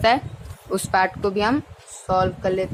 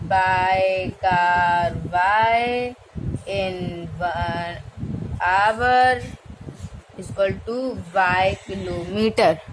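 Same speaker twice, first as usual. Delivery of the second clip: drawn-out, word-by-word dictation pace; slow dictation; 4 semitones lower, much slower than usual. Shown as a demonstration. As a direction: dictate slowly as you write it on the board. Teacher dictates while writing. (धीमे-धीमे लिखवाते हुए बोलना)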